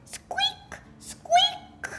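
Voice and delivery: very high toy squeak; short, high-pitched squeaks; squeaky toy. Acted. Squeaky toy telephone noise.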